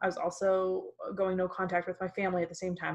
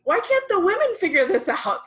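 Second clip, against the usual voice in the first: much higher and louder, half-laughing disbelief; exasperated thought; 11 semitones higher, 7 dB louder than usual. Retold or imitated. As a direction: throw up your hands at the silent men.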